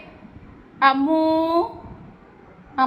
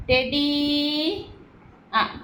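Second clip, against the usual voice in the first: ordinary talk, nothing marked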